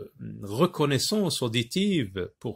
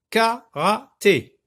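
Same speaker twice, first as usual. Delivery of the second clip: Karaté as three loud, high beats, ka-ra-té; slow, separated KA, RA, TÉ; syllable by syllable; 7 semitones higher, 6 dB louder, much slower than usual. Shown as a demonstration. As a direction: sound out Karaté in pieces. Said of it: "lorsque je veux découper".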